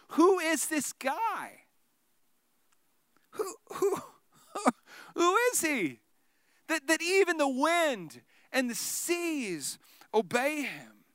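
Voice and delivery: high, slow, breaking with wonder; trembling awe at the miracle; awestruck. Acted. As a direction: choke up in disbelief. Disciples stunned, near tears.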